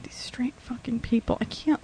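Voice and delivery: whisper. Real, not acted. Exasperated aside about straight people.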